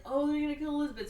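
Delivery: high and fast, a hammed-up sudden alarm; mock panic. Dalton realising the danger, high, fast mock panic. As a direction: blurt it out in a high rush. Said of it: "He's like".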